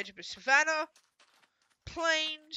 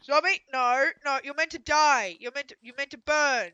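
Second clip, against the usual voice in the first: loud, high frustrated yelling; 5 semitones higher, 11 dB louder than usual. Real, not acted. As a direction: yell at the zombie in dismay. Yelling at a zombie.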